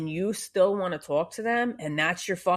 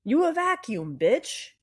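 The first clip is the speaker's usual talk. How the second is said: sassy punchline; 7 semitones higher than usual. Acted.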